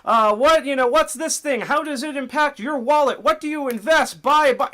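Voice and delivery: high, loud hammed-up finance bro; mock crypto investor. Mocking crypto investor hype for his viewers, high and loud. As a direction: squeal the hype like a pushy investor. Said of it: "a ton of investor stuff".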